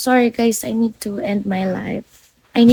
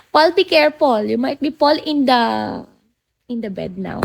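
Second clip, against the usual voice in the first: ordinary delivery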